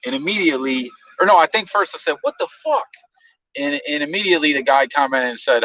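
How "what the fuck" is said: high, startled "what the fuck"; shocked outburst. Retold or imitated. Host retelling his shock at the troll, high and startled. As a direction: react as if you just saw something disgusting.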